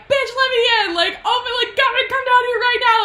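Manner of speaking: very high, loud shrieked demand; hammed-up yelling. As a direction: yell up at a window, frantic and exaggerated. [name] yelling up at window, acted.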